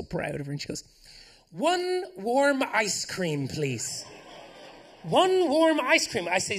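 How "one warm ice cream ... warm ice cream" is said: put-on high, slow little girl voice; toddler ordering. Toddler asks the ice cream lady for warm ice cream, high and slow. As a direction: ask sweetly like a small child.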